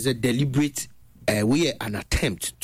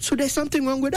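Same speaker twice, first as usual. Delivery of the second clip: high, fast, rising disbelief; incredulous repeat; 12 semitones higher, faster than usual. Real, not acted. Host pressing the guest, high fast incredulous. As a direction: fire the question back, pitched right up.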